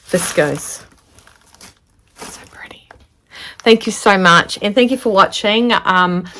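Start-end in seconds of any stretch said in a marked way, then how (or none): 2.2-2.9 hushed, breathless whisper of wonder; awed whisper. Real, not acted. Vlogger unwrapping a friend's fabric gift, whispering in awe. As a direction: whisper it like you can't believe it.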